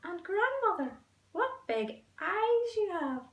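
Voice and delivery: put-on wide-eyed small girl, wondering aloud; little girl voice. Girl staring at the wolf's big eyes, high slow voice. Girl questioning the disguised wolf.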